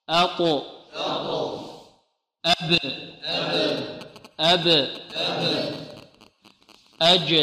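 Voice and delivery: each letter sounded with a bounce; تطبيق القلقلة; qalqala drill. Shown as a demonstration. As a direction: bounce each stopped letter, pausing between. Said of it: "نطبق الآن".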